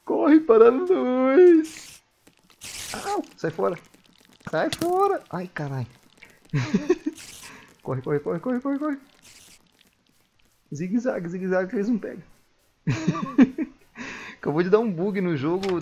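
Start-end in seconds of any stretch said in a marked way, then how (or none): 0.1-5.9 panicked high shout, slow; being chased. Real, not acted. Monsters chasing him at night. 6.5-7.6 nervous laugh. Real, not acted. Nervous laugh while fleeing. 7.8-12.2 high, urgent chant of orders; being chased. Real, not acted. Fleeing from zombies. 12.9-13.7 relieved high laugh. Real, not acted. Laugh after escaping.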